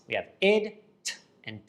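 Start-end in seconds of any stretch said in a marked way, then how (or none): 0.4-0.8 "id" as its own short syllable, pitched up; ED sound "id". Shown as a demonstration. Teacher shows students three ED sounds, sounding out "id". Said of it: "The E D ending makes three different sounds". 1.0-1.1 "t" as a bare voiceless tap; ED sound "t". Shown as a demonstration. Teacher shows students three ED sounds, sounding out "t". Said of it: "The E D ending makes three different sounds".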